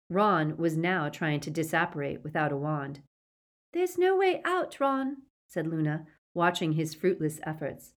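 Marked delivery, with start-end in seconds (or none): 3.7-5.2 put-on high, airy-pitched girl character; Luna's character voice. A narrator's reading. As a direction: voice Luna well above the narration. Luna's dialogue voiced by the narrator.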